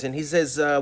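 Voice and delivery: normal talking voice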